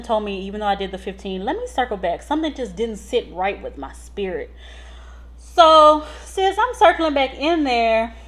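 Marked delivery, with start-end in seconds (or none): 5.4-8.1 high, loud, drawn-out telling; dramatic urgency. Retold or imitated. Nurse telling her sister the scare, dramatic. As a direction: build it up like a cliffhanger.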